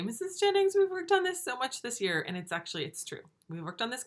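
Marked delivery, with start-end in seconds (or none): 0.0-2.2 high whiny student voice; mock-complaining kid; imitating a student. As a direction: play a pupil protesting, pitched up. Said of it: "something that you might say".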